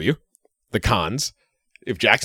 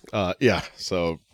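usual speaking voice

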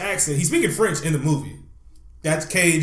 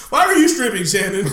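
raised, high-pitched mock demand; louder, higher acted challenge; mock questioning; 4 semitones higher, 7 dB louder than usual. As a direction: playfully act out an incredulous question. Acting out someone questioning the stripper.

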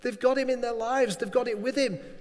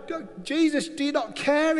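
panicked plea; 6 semitones higher, 3 dB louder than usual. Acted. Disciples accuse sleeping Jesus.

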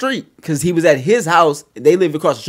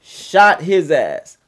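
slow drama; 4 dB louder, much slower than usual. Acted. Dramatic slow story climax.

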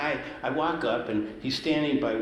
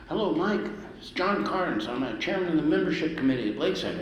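mock official caller; 6 semitones higher than usual. Chairman phones him, quote voiced.

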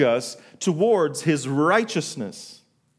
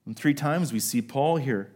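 usual speaking voice

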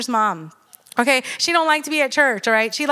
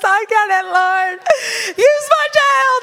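high, louder, slow voice breaking in tears; tearful surrender; 9 semitones higher, 4 dB louder, slower than usual. Real, not acted. Mother surrendering her child to the Lord, voice breaking in tears. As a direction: give up control to God, crying.

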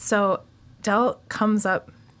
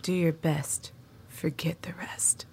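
soft, fading to a whisper; Del's hushed advice; 7 dB quieter, slower than usual. Retold or imitated. A hint of Del whispering advice to the bedridden student. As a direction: hush it close to her face, ending in a whisper. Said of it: "what he said to me was".

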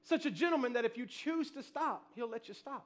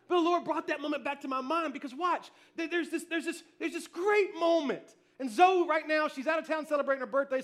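usual speaking voice

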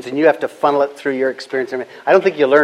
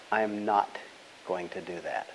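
low, soft and steady, no raised voice; calm firm refusal; 6 semitones lower, 15 dB quieter than usual. Shown as a demonstration. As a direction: say it quietly with total finality. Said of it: "it was like I shouted back at them".

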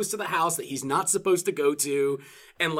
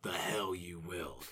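growled one-liner; 9 semitones lower, 13 dB quieter, slower than usual. Retold or imitated. One-liner quoted in passing.

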